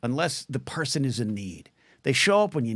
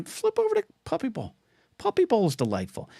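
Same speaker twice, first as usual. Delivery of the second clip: playful sing-song; 10 semitones higher than usual. Acted. Cheerful Puppy Bowl pitch.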